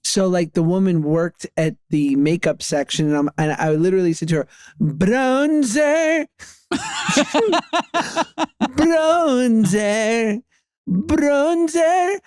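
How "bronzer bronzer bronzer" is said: put-on high, drawn-out Italian-accented Bronzer; English word said in a mock Italian accent; fake Italian accent. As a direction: sing out Bronzer like a bad Italian. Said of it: "English words in an Italian accent".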